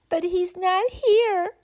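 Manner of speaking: a little boy's lightly acted wail for his teddy. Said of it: "wailed Billy".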